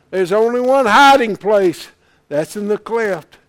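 loud, high, driving sermon climax; preaching at full force. Real, not acted. Preacher shouting to the congregation at full force. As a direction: build it up and shout it out.

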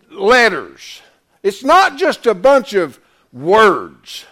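loud, emphatic sermon delivery; full-force preaching. Real, not acted. Preacher expounding Isaiah's vision to the congregation, loud and emphatic. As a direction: drive the scripture home, loud and punched.

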